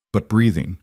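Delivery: ordinary delivery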